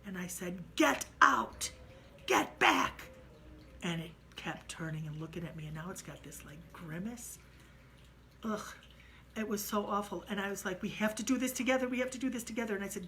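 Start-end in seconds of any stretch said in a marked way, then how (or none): 0.8-3.0 loud, higher commanding shout; angry shout. Retold or imitated. Narrator ordering the ghost away, angry shout. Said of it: "I sometimes get really angry". 10.8-12.8 fast, louder urging; urgent plea. Retold or imitated. Narrator urging frightened Jenny to stand firm, urgent fast plea.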